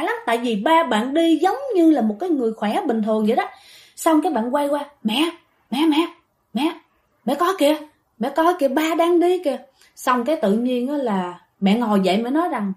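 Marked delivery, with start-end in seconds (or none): 5.0-9.6 higher-pitched, short repeated calls to mother; child calling out "look" in alarm, mildly acted; urgent calling. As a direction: alert mom in a retold urgent call.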